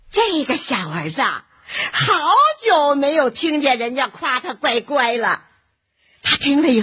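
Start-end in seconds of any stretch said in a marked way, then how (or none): none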